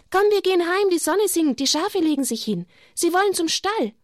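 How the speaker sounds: high, bright-voiced girl calling; eager little girl. Jacinta calling Lucia to go home with the sheep, high.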